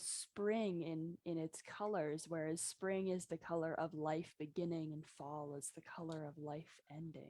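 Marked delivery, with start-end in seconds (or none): 4.9-7.3 whisper. Real, not acted. Host muses on fall colors.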